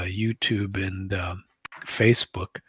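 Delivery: usual speaking voice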